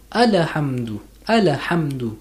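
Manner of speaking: Alhamdu with a clear h, not Allah; correct Alhamdu. Shown as a demonstration. Teacher correcting a common error, careful pronunciation. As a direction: say Alhamdu with the h sounded. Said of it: "It's not Allah, it's Alhamdu".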